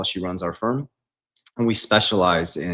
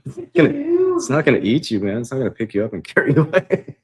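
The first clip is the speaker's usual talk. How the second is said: higher and louder, laughing through the words; laughing teasing; 6 semitones higher, 6 dB louder than usual. Real, not acted. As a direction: tease a friend scared of a fly, cracking up. Laughing at someone scared of fly.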